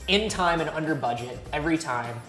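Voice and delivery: ordinary talk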